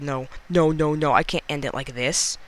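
normal talking voice

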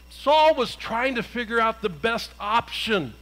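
ordinary talk

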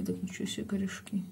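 amazed whisper. Real, not acted. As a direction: breathe it in wonder. Amazed at sprouted seeds.

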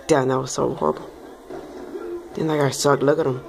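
normal talking voice

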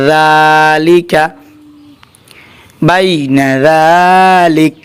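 বাইনা যালিক stretched very slowly; slow melodic recitation; drawn-out recitation. Shown as a demonstration. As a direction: hold each vowel long. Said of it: "ওয়াকফ করলে".

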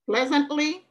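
normal talking voice

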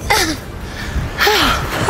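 breathy, weary laughing sigh; tired sigh. Real, not acted. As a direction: sigh out the effort with a half laugh. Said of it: "my quads are so sore".

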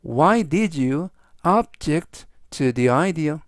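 very slow, spaced model question; slow model sentence. Shown as a demonstration. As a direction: read it very slowly for learners. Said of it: "즉 전체적 의미는".

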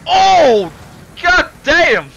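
high, loud cry at the crash; shocked yell. Real, not acted. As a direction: shout it at the wreck. Big crash on screen.

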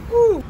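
high whoop at the heat; spicy woo. Real, not acted. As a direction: let out a sharp woo from spice. Reacting to spicy sauce.

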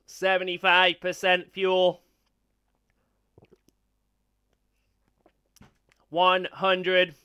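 slow, flat robot voice through a vocoder. Pretend. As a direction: speak like a glitching machine. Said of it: "I'm gonna turn on the robot".

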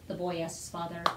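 ordinary delivery, nothing marked

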